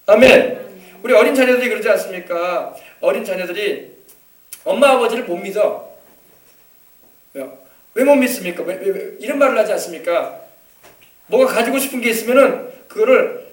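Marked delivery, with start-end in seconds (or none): none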